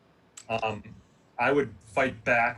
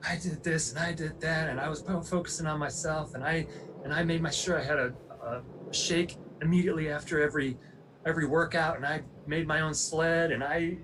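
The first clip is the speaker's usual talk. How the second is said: higher, fired-up rapid listing; 5 semitones higher than usual. Retold or imitated. Athlete, fired up, lists his training to the psychologist, higher and heated. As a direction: rattle off the list like you're proving him wrong. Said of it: "I was so fired up and so mad at him".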